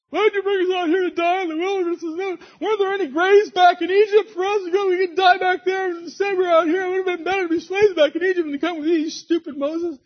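high, loud, fast mock whining; hammed-up hysterical complaint in a put-on high voice; panicked complainers. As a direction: wail it like a panicking crowd. Acts out panicking Israelites complaining to Moses.

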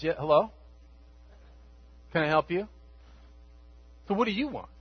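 offhand, mildly put-out; a mock what-do-you-want; casual brusque Jesus. Jesus turning on his followers, lightly mock brusque. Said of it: "Did he say it like that?".

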